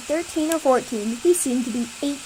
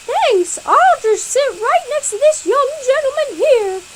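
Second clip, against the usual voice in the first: high, louder cartoon cat voice; 12 semitones higher, 6 dB louder than usual. Cat thanking the kids, in a high, louder voice. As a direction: play the cat, bright and high. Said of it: "the cat said".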